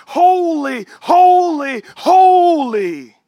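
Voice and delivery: loud, ringing "holy" proclaimed; angels' praise. Retold or imitated. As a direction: call out like the angels crying holy. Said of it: "it's like the angels".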